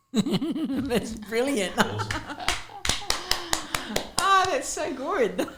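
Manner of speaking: high laughing delighted praise. Real, not acted. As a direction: laugh through the compliment. Listener laughs after poem.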